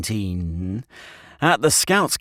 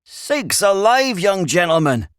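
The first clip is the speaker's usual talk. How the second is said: high, loud rustic exclamation; startled; 11 semitones higher, 5 dB louder than usual. A narrator's reading. Shepherd aghast at Stanley's plan, startled. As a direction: draw out the shock as an old shepherd. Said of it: "said the shepherd in a startled voice".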